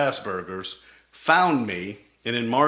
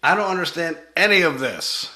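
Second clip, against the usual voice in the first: higher, bewildered "what are you talking about"; baffled outburst; 7 semitones higher, 3 dB louder than usual. Retold or imitated. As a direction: throw up your hands, totally lost. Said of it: "I was like".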